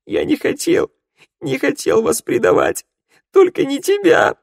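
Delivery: high, loud sobbing cry; wailing it, desperate. A narrator's reading. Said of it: "рыдал Раду".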